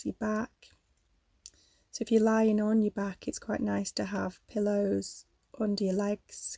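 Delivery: calm yoga nidra guidance with long pauses; guided meditation. Real, not acted. Leads a yoga nidra relaxation.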